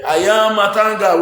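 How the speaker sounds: hammed-up indignant quote; imitating the actress. Vlogger mimicking a drama actress's famous line, hammed up. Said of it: "that woman will say".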